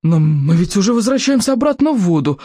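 stuttering, panicked buts; stammering in horror. A narrator's reading. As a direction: stumble over the words in fright. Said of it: "От ужаса ... начала заикаться".

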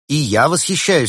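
keen, emphatic me-too; convincing admiration. A narrator's reading. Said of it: "у него это прозвучало убедительнее".

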